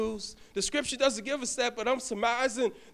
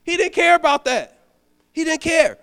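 emphatic preaching; 7 semitones higher, 9 dB louder than usual. Acted. Preacher stresses Jairus's resolve.

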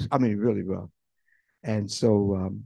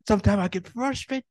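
higher, louder complaint; frustrated believer; 8 semitones higher, 4 dB louder than usual. Acted. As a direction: vent like a worn-out believer.

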